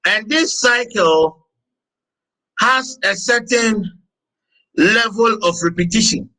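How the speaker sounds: slow, deliberate teaching with long pauses; measured lecture. Acted. Teacher explaining the cycles of life to students, measured lecture. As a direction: dictate it slowly, phrase by phrase.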